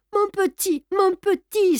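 put-on high, begging old servant's voice; old servant begging him to stop; pleading old woman. A narrator's reading. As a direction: plead like a frightened old nurse. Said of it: "supplia Victoire".

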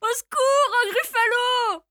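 high, loud cry for help; terrified little mouse yell; frightened mouse cry. Acted. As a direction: shout in panic at a monster.

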